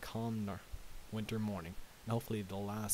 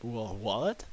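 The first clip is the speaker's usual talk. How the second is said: louder, startled stammer rising into a question; startled gasp; 6 dB louder than usual. Acted. Twilight gasps at the shivering pony at the door, startled. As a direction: blurt it out in surprise. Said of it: "Twilight gasped".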